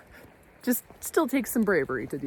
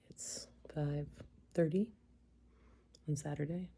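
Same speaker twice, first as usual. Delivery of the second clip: whispering; 7 dB quieter than usual. Real, not acted. Early morning, snuggling.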